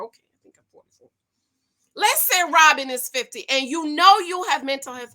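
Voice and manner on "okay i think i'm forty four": quick whispered afterthought to herself; whispered aside. Real, not acted. Unsure of her own age, a quick whispered aside. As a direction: breathe it out fast under your breath.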